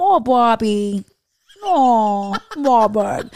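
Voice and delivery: a drawn-out, exasperated cartoon mom; mom from Bobby's World. Imitating the Bobby's World mom, slow and loud. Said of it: "And the mom".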